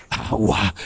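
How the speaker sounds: very soft, low plea; possessed murmur. Acted. Possessed person's request acted again. (murmullo de poseído)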